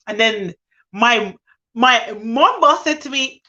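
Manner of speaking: usual speaking voice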